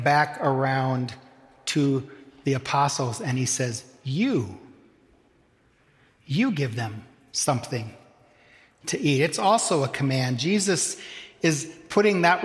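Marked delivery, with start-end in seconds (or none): 4.0-9.2 "You, you" doubled, with the weight on "you"; emphasis on you. Shown as a demonstration. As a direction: lean hard on "you", then say it again. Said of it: "the command is emphatic with the emphasis falling on the word "you".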